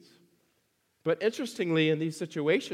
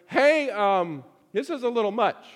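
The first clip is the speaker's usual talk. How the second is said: raised, hesitant mock complaint; fed-up disciples; 5 semitones higher, 5 dB louder than usual. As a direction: voice the disciples' awkward objection. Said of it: "the disciples are going".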